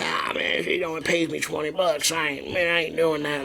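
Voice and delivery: mock a small-town comic in a country accent; a touch of country accent, grumbling about pay. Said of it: "I'm giving my country accent".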